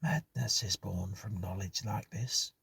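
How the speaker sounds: hushed tone. Acted. Hughes to the others after the ghost, hushed. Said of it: "Hughes spoke in a hushed tone".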